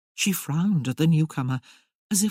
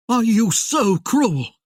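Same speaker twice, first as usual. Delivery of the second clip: loud, slow, higher anguished shout; 7 semitones higher, 6 dB louder, slower than usual. Acted. Merlin shouting at Tamara so loudly Sarah winces, slow and high. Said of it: "shouted, so loudly that Sarah winced".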